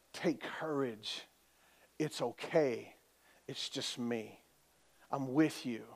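very soft, low, slow comfort; calm reassurance. Acted. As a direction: reassure them gently, leaving room between phrases. Preacher voices Jesus comforting the troubled.

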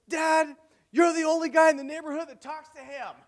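loud, squealing high falsetto; imitated teenage daughter. Teenage daughter scolding dad about the neighbor, high-pitched exasperation. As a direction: put on an exasperated teen girl's high voice. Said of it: "my sixteen-year-old daughter, she's like".

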